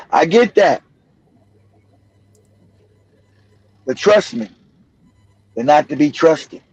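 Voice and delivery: low, gentle reassurance; calm fatherly talk; reassuring father. Retold or imitated. Father reassuring son in retold talk.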